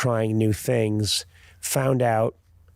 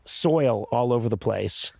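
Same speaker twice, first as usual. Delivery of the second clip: ordinary talk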